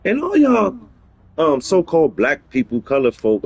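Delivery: ordinary talk, nothing marked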